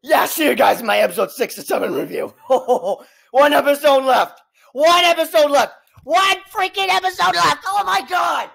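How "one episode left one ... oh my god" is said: building disbelief. Real, not acted. Fan with one episode left, building disbelief. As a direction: repeat it with mounting agitation. Worked up over finale.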